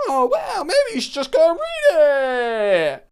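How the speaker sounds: very high, drawn-out sarcastic wail; mocking whine. Call handler mocking customer who skipped the email, high sing-song whine. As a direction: throw the whine back, stretching the last words.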